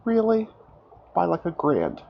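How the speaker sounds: sharp high disbelief; incredulous. Real, not acted. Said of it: "Oh, really?".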